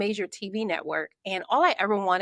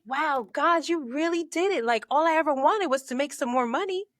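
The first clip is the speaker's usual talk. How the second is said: delighted; 7 semitones higher than usual. Retold or imitated. Thanking God for raise.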